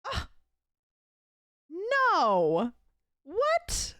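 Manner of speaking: a high offended squeak; Caroline offended scoff. Acted. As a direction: scoff like you're deeply offended. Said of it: "The Caroline offended scoff".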